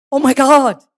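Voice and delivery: high-pitched horrified realisation; retold horror. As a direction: let the horror lift your pitch. Retold horror at moving spiders.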